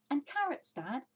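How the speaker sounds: high, eager little voice; a small child piping up; small fox. Acted. Smallest fox calling to his dad, high and eager.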